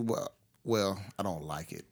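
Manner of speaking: ordinary delivery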